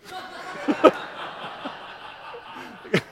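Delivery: long breathless silent laugh; real laughter he can't hold back; helpless laugh. Real, not acted. Child's swear makes parents laugh.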